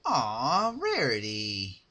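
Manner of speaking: mock pity. Pinkie cooing over tearful Rarity, mock-sympathetic. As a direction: coo it with fake sympathy.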